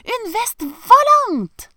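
slow, high, loud yell; triumphant shout. A narrator's reading. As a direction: stretch it out in an excited cry. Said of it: "lui cria le professeur".

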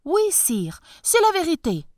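high, loud humble peasant voice; bow and scrape to the tsar; humble farmer. Acted. Farmer insists.

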